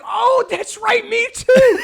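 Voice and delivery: high, loud, drawn-out comeback; mock-surprised sarcasm. Retorting to complaining woman over ticket price, loud sarcasm. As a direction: exclaim it with exaggerated sarcasm.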